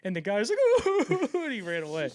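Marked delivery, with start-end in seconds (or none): 0.9-1.3 high startled squeal; scared yelp. Retold or imitated. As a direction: yelp and run. Scared executive reaction.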